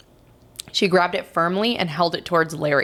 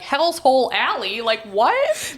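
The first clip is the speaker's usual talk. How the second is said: mock-baffled, higher read-out; reading signs aloud; 7 semitones higher, slower than usual. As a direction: read it out in exaggerated disbelief. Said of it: "I would read street signs".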